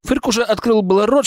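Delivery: usual speaking voice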